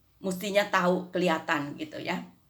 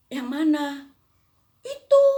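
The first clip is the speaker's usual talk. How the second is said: put-on child asking; 8 semitones higher, 7 dB louder than usual. Child's reply in story.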